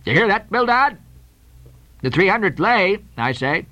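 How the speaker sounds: blustering, emphatic shipowner; insistent captain. Acted. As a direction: make sure your stubborn partner hears. Peleg overrules Bildad.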